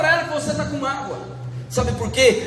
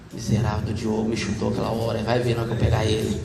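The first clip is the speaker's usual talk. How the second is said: much lower, softer grumble, speeding up at the end; resentful grumble; 6 dB quieter, faster than usual. Retold or imitated. Man lying in bed still angry at Diogo, low muttered grudge. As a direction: mutter the grudge darkly into the pillow.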